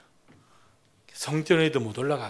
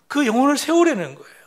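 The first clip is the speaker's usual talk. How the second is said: raised, louder preaching line; preaching emphasis; 9 semitones higher, 9 dB louder than usual. Real, not acted. Preacher to congregation, pressing home the point, loud. Preacher stressing soul healing.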